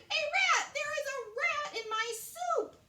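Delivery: very high, loud screaming; screaming queen. A narrator's reading. Queen screaming about the rat in her soup. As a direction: shriek in panic. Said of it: "the queen screamed".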